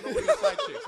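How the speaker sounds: laughing. Real, not acted. Joking outrage while laughing.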